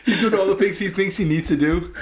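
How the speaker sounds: high, giggly running commentary; laughing. Real, not acted. Friend jokes about another's antics, laughing. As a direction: crack up while piling on the joke. Joking along, group cracking up.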